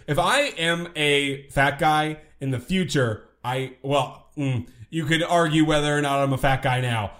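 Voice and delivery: ordinary talk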